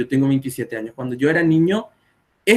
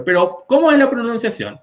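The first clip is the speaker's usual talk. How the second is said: high, confused student question; puzzled students; 9 semitones higher than usual. Retold or imitated. Students ask the teacher which playa is right. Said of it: "me preguntan los alumnos".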